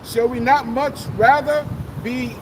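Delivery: ordinary delivery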